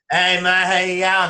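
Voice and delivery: a mild mock of his casual hello; a hint of a friendly Aussie footballer saying g'day; blokey footballer greeting. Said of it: "That's how he talks".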